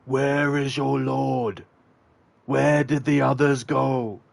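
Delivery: slow, high, drawn-out mock yell; imitated interrogation yelling. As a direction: stretch it out like a game character's repeated demand. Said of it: "the character keeps yelling out".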